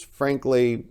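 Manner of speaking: ordinary delivery, nothing marked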